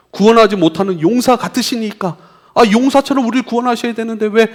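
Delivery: high, loud challenge to God; indignant appeal. Acted. People asking why the Saviour is powerless, in indignant appeal.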